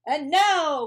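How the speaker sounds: high, loud proclamation; cried out. A narrator's reading. Max as king starting the party, loud high cry. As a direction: announce it to the crowd. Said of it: "cried Max".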